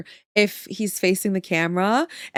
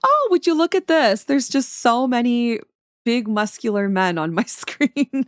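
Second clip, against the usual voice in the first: hammed-up amazement that slows down; mock astonishment. Artist reacting to buff men on screen, mock astonishment.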